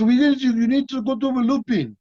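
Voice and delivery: high, excited French impression; excited, foreign-accented mimicry; Jean-Claude. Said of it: "he was all excited".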